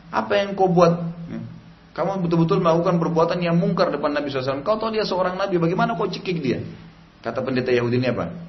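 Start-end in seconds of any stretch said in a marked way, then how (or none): 0.1-6.6 indignant scolding. Retold or imitated. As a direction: demand an answer, outraged. (Umar membentak pendeta dengan nada tinggi dan marah)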